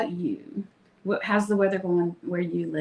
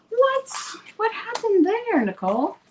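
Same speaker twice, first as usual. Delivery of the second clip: much higher mock-astonished squeal; mock shock; 14 semitones higher than usual. Host voicing her viewers' shock that she made no stencils, mock surprised. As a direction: play the shocked fan scolding yourself.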